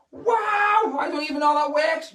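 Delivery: high, loud shouting wonder; excited shout. Acted. Playing amazed for young viewers, loud. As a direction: keep the fake awe big.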